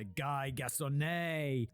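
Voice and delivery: drawn-out mock-French name, stretched slowly; French accent. Shown as a demonstration. Presenter guessing at his subject's French name, exaggerated French accent. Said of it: "a bit of a French accent".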